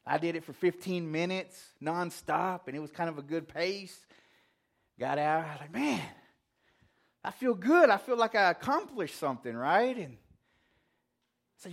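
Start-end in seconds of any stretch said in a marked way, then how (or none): 5.7-9.5 higher, louder proud burst; excited. Retold or imitated. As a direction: relive the rush of feeling good. Proud after the workout.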